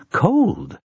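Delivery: high shivery yelp at cold water; shivery little cry. A narrator's reading. Woman splashing cold water on her face, high shivery cry. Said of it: "gave out a little shivery cry".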